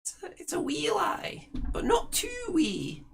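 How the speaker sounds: put-on high old hag approving; crone voice. Ethel praises her sister's plan, high crone voice.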